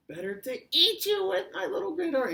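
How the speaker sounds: exaggerated high mock-grandma attack; wolf pretending Grandma. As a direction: pounce in a squeaky fake-granny voice. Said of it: "pretended like he was Grandma".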